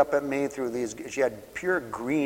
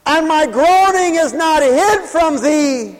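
higher, louder and slow, a pleading cry; mother's prayer; 11 dB louder, slower than usual. Retold or imitated. Weeping mother crying out her prayer to the Lord, loud. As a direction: cry it up to the Lord like she did.

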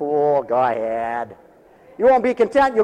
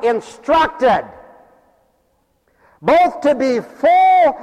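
loud, slow, drawn-out preaching; full-force preaching; 4 semitones higher, 4 dB louder, slower than usual. Acted. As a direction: preach it big to the congregation.

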